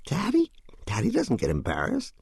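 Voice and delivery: put-on first word leaps high in childish disbelief; incredulous young son. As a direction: voice a small boy scoffing at the idea, starting very high. Narrator voices incredulous son.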